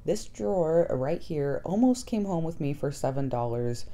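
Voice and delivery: ordinary talk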